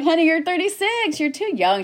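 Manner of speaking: high, loud motherly protest; imitated dismissive mom. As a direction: put on mom's high-pitched insistence. Mom dismissing her worry, retold.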